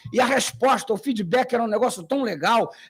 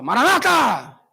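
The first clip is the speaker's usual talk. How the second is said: louder, pushed-out call; forced greeting; 2 semitones higher, 5 dB louder, slower than usual. Pretend. Adventist forced into the greeting, louder. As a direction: push it out like you were prompted.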